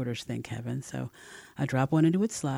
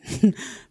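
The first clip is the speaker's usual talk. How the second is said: short unvoiced laugh; an airy burst of laughter; breathy laugh; 8 dB louder than usual. A narrator's reading. Laughing friend.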